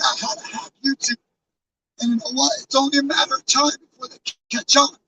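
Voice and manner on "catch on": normal talking voice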